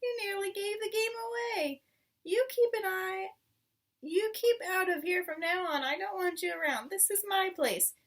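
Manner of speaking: high, shrieky rat voice; a rat shrieking at the foxes. Acted. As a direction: scold like a shrill nasty rat.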